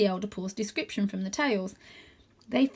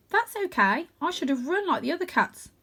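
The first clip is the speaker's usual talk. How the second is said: put-on higher, young cat; 8 semitones higher than usual. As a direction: speak as a kind young cat.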